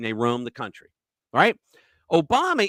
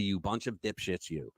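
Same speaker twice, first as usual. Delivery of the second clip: ordinary talk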